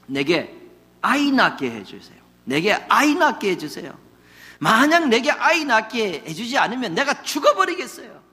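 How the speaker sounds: a touch of a desperate wife begging, rising as she goes; half-acted desperate plea for a child; pleading wife. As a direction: plead harder with each line. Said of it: "이렇게 덤벼드는 겁니다".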